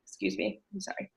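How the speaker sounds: holding back a sneeze, very soft; stifled, breathy, sneeze coming on; about to sneeze. Real, not acted. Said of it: "Sorry, I was about to sneeze".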